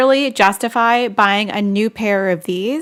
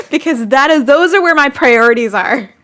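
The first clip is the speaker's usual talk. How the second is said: louder, firm statement of priorities; louder, firmly insisting "priorities"; emphatic declaration; 3 semitones higher, 5 dB louder than usual. Real, not acted. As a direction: declare it proudly. Defending her spending, then repeating.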